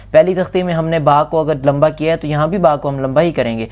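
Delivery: ordinary talk, nothing marked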